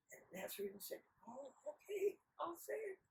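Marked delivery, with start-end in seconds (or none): 1.3-3.0 small, high, soft voice; meek little simpleton; meek agreement. Acted. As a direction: give in timidly, high and quiet. Nasrudin meekly agrees.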